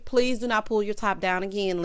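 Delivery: ordinary delivery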